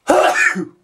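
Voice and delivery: sharp explosive sneeze; loud sudden sneeze; violent sneeze. Real, not acted. Sneezing test with eyes open.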